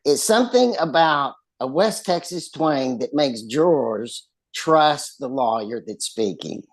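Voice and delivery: normal talking voice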